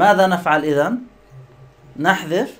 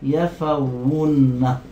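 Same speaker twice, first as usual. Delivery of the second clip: drawn-out word; 3 semitones lower, much slower than usual. Shown as a demonstration. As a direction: stretch يفون very slowly with held doubled n. Teacher sounds out the pattern. (يفونّ ببطء شديد)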